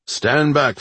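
loud, high call to the crowd. Acted. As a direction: command the crowd to clear. Judge pushing through the crowd.

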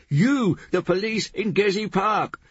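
high, furious shouted accusation; shouting. A narrator's reading. Gonja to the policeman about Gezi Park, shouting. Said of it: "Gonja shouted".

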